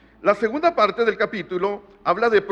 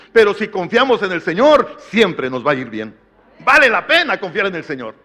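preaching climax; 6 dB louder than usual. Acted.

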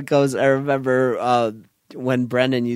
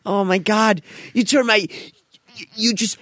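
high, halting, overwhelmed babble; mock mind-blown; 8 semitones higher than usual. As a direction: act utterly mind-blown, pitched way up. Acted mind-blown reaction to pitch.

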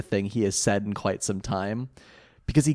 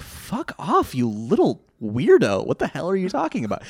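mock bully; 6 semitones higher than usual. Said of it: "the bully just looks at him, and he's like".

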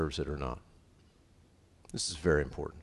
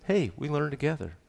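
light, raised, friendly tone; sweet reply; 7 semitones higher than usual. Acted. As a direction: chirp it like a team player. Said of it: "You say".

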